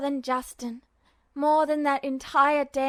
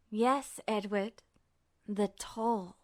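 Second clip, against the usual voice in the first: slow, low and softer, savouring it; cold smiling Truth; 4 semitones lower, 6 dB quieter, slower than usual. A narrator's reading. Truth confirming Ed's fear, slow and cold. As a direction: let the answer sink in coldly. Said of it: "Truth smiled coldly".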